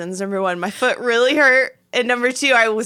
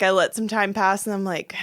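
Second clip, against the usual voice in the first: ordinary talk, nothing marked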